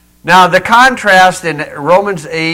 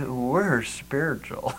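hushed, slow, self-satisfied mock piety; mock-smug, low and very soft; 3 semitones lower, 13 dB quieter, slower than usual. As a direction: draw it out softly, pleased with yourself. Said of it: "all smug and say".

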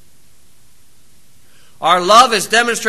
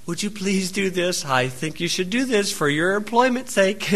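much softer, mock-polite request; a hint of an oddly gentle boss; understated mock courtesy; overpolite foreman; 9 dB quieter than usual. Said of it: "your foreman saying to you".

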